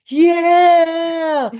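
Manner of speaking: high, loud whoop of joy; thrilled yell. Retold or imitated. Son on the field answering dad, a high, loud joyful shout. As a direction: shout yeah like an overjoyed kid.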